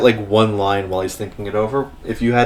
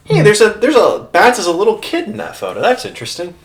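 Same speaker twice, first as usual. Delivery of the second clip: sudden high excited realization; surprised; 11 semitones higher, 4 dB louder, faster than usual. Real, not acted. GM surprised by the photo clue. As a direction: blurt it out, caught off guard.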